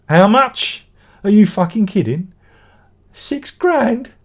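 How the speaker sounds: loud, high disbelief at the price; real shock at a price tag, rising to a yelp; shocked outrage. Real, not acted.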